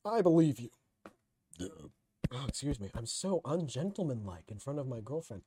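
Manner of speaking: usual speaking voice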